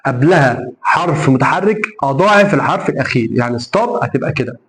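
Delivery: ordinary delivery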